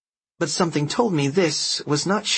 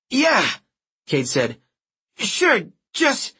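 nervous agreement; 9 semitones higher, slower than usual. Acted.

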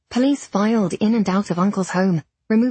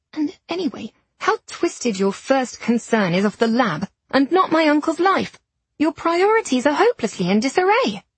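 higher, heated indignant scolding; 6 semitones higher than usual. A narrator's reading. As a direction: tear into him indignantly.

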